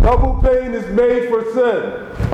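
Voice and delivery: high proclaiming cry. Shown as a demonstration. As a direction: call it out to the whole room. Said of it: "let him cry it out to you".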